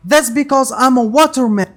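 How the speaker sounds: high, loud and quick taunt; loud boast. A narrator's reading. Luffy taunts the bandit who cannot grab him, loud boast. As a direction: crow it out fast and high.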